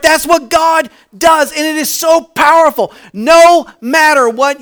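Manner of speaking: loud, high preaching cry; preaching shout. Real, not acted. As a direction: lift it into a joyful shout.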